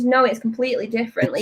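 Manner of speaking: ordinary delivery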